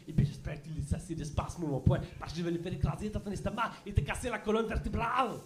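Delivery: quick French action-hero dub; a touch of a tough French dub voice. Retold or imitated. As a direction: rattle it off like a dubbed movie. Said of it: "kinda sorta sounds like".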